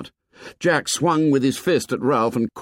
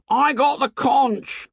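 high shout over booing. A narrator's reading. Piggy shouts over the booing tribe, high shout. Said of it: "he shouted".

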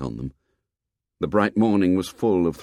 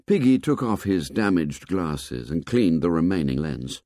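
ordinary delivery, nothing marked

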